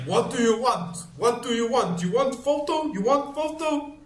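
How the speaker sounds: high-pitched mock shouting in broken English; shouting foreigner. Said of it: "he starts shouting at me in English".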